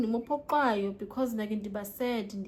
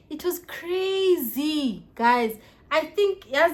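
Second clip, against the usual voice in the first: exclaiming; 6 semitones higher, 10 dB louder, much slower than usual. Real, not acted. Exclaims to viewers.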